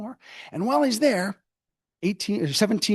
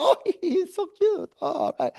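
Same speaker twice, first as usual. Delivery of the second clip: high, laughing mock-fawning gush; 13 semitones higher than usual. French partygoers gushing over Franklin, high mock coo. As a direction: coo over him like a charmed Parisian socialite.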